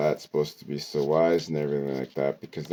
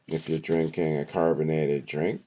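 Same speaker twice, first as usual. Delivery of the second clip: ordinary talk, nothing marked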